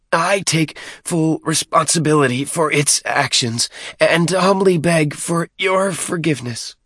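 high-pitched, abject pleading; pleading underling. A narrator's reading. Deacon on his knees before Maccabeus, pleading. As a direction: beg humbly in a high voice. Deacon kneels begging forgiveness.

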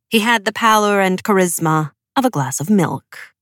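ordinary delivery, nothing marked

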